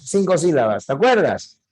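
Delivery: ordinary talk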